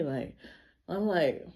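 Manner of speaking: usual speaking voice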